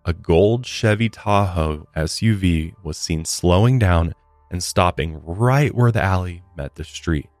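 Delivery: ordinary delivery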